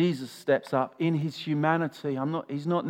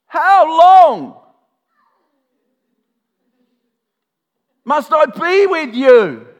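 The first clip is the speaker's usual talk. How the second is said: very loud, high, drawn-out exasperated bellow; hammed-up cry of exasperation; frustrated shout; 13 semitones higher, 17 dB louder, much slower than usual. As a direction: roar it out, stretching the words. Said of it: "Can you hear the frustration?".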